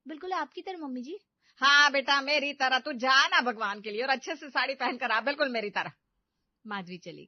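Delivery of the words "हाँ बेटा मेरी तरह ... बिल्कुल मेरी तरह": loud exasperated pleading; mother-in-law at the end of her tether. Acted. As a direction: beg her, loud and fed up.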